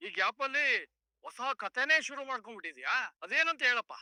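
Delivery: loud, high-pitched scoffing; sarcastic challenge. Acted. Policeman doubting the suspect's new tale, sarcastic. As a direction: challenge his story with loud disbelief.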